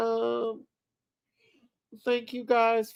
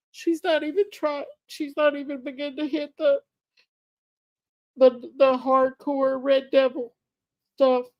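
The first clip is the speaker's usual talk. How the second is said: tearful, higher voice, breaking off mid-word; choking up while warning the viewers; emotional; 4 semitones higher, 3 dB louder than usual. Real, not acted. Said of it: "I feel really emotional right now".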